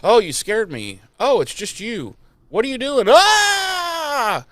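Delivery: rising from startled to a high screaming shout; mock scared character rising to a scream; startled then screaming.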